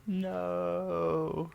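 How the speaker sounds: long, high, loud no; drawn-out cry of dismay; dismayed reaction. Real, not acted. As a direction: stretch the word out in dismay. Listener reacts to the crushed case.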